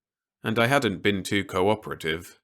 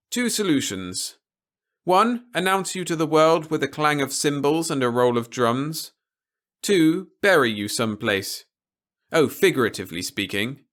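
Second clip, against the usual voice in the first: a lightly raised, measured officer's voice; stern officer; 8 semitones higher, 5 dB louder than usual. A narrator's reading. Officer warning Peter against anything rash, lightly voiced and grave. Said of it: "he added hastily".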